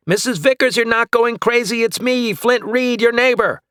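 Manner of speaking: high, loud reassurance; calling out. Acted. Flint calling to his neighbor, loud. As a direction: call out clearly to be heard.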